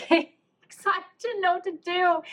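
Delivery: high-pitched words squeezed through real laughter; laughing while talking. Real, not acted. Storyteller admitting she was lost, helplessly laughing. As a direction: crack up while confessing. Laughing at impromptu bakery therapy session.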